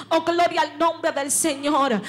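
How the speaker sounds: normal talking voice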